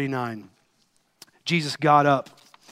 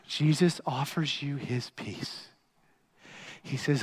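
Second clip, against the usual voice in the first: normal talking voice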